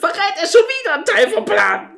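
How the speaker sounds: high, loud, laughing through the words; exasperated giggling rant. Real, not acted.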